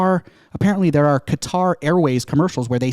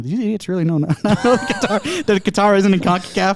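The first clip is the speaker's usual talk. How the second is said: imagined listener; 6 semitones higher than usual. Retold or imitated. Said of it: "people aren't listening going".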